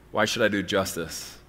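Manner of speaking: usual speaking voice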